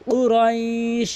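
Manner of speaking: "কুরাইশ" slow, tajweed-correct Arabic; correct Quranic pronunciation. Shown as a demonstration. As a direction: say "কুরাইশ" slowly, qaf from the back of the tongue. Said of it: "কুরাইশ এভাবে কিন্তু পড়তে হবে".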